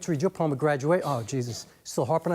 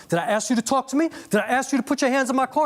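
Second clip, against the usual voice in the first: angry confrontation; 6 semitones higher than usual. Retold or imitated. The man from the car warning Saint off, retold angrily. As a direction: throw it back at him like a threat. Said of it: "He was like".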